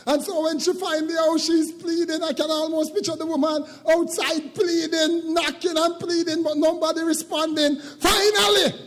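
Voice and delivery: high, loud, sung-out preaching cadence; preacher rising into a chanted sermon climax; full-force preaching. Acted. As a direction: whoop it up to the congregation.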